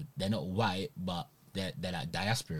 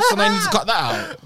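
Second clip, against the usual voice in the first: high, fast, laughing through it; 8 dB louder, much faster than usual. Real, not acted. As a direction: crack up while saying it. Same line repeated laughing.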